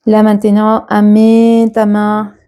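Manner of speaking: unhurried meditation teacher, stretching key words; guided meditation. Acted. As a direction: guide them gently through the body, leaving long pauses. Leads a guided body scan.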